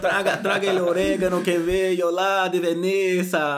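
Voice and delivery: put-on Italian accent. Mocks gourmet Italian chef.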